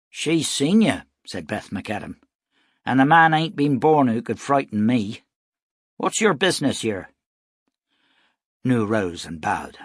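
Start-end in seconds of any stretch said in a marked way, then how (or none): 0.1-1.0 loud, higher, confronting woman; brusque challenge. A narrator's reading. Beth confronts the stranger. 2.8-7.1 loud, higher, challenging woman; tough frontier woman. A narrator's reading. Beth challenges the stranger.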